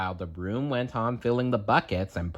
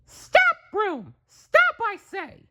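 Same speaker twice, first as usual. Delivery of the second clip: Mickey crying stop; slower than usual. Acted. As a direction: yell it up high in a panic. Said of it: "cried Mickey".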